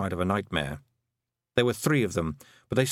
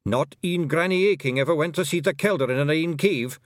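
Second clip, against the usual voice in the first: sharp, louder Scots retort; snapping retort; 6 semitones higher, 3 dB louder than usual. Acted. Rob Anybody snaps at a Feegle, sharp Scots. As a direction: bite back at the suggestion, Scots burr. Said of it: "snapped Rob Anybody".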